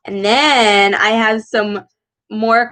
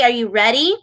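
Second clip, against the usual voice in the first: ordinary talk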